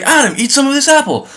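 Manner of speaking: lightly voiced Eve offering the apple; coaxing Eve.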